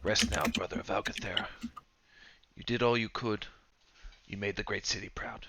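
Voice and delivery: hushed, tender deathbed blessing; solemn farewell. Acted. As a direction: lay a dying brother to rest softly. Last words over dying comrade.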